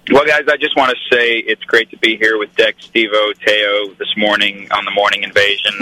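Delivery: put-on American accent, measured delivery. Shown as a demonstration. Guest showing off his accent to radio hosts, American accent. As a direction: sound like an American presidential candidate. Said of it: "with an American accent".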